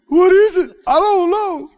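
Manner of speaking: panicky, voice shooting up; frightened fishermen. Acted. Scared fishermen asking each other what walks on the water, panicked.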